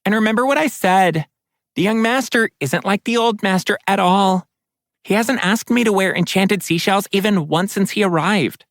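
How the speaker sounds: chatty water spirit, pitched high; bright gossiping spirit, up high. Acted. Water spirit Nerissa speaks.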